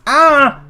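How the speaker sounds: short, loud, high "Ah!"; sudden high shout of frustration; frustrated yell. Real, not acted. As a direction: yelp out in exasperation. Frustrated yell during game.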